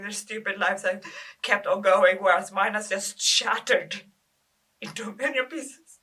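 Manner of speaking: loud, resentful build; bitter outburst. Acted. Bereaved girl resenting her friends' untouched lives, loud and bitter. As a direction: let resentment burst out loudly.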